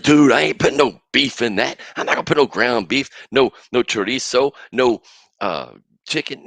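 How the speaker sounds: loud, indignant listener refusing meat; half-playful protest at full volume; mock objection. Host voicing a listener who won't put beef in it, loud. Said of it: "And you may be like".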